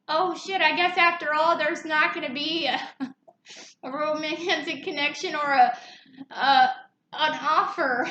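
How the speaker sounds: the fallen page sighs it off half laughing; hint of the card voice.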